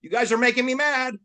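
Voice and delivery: high, fast cartoonish boast; trash-talking threat. Racer psyching out rivals at the start line, high fast mock boast. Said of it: "I go".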